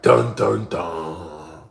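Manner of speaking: loud "dun, dun, dun" drumbeat; suspense sting. Retold or imitated. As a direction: hum a dramatic suspense sting. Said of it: "It was the moment of truth".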